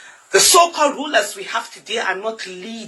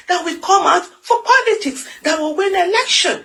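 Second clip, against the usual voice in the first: impassioned; 8 semitones higher than usual. Real, not acted. Rising passionate outcry.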